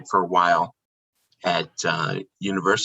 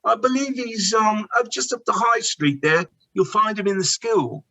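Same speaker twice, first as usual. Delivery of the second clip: put-on much higher, polite English-accented deflection; English shopkeeper accent; 13 semitones higher than usual. Deflecting a guest about Harry, higher polite English accent. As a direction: stay in character in an English accent. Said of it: "And I'll say".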